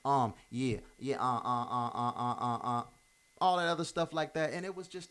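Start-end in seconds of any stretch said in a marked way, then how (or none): 0.1-2.8 imitating an endless string of uh fillers; hesitant filler talk. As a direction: stumble from one uh to the next, mocking his old habit. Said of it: "Used to say".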